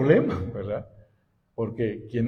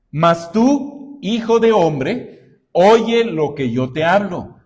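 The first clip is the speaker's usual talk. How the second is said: loud, raised preacher's reading voice; scripture reading; 5 semitones higher, 6 dB louder, slower than usual. Acted. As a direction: read the verse out high and forcefully to the congregation. Reads scripture aloud to congregation. (lectura bíblica en voz alta y enérgica)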